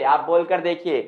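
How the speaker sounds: normal talking voice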